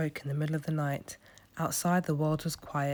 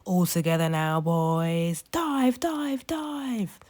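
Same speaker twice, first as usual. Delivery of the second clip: high squawky rallying call; seagull leader; 3 semitones higher, 4 dB louder, slower than usual. A narrator's reading. Gull leader calling the flock to dive, squawky shout.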